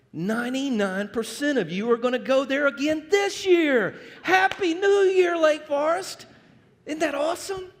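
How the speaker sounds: loud, high New Year cheer; rally the crowd up high; excited shout. Real, not acted. Pastor hypes the congregation.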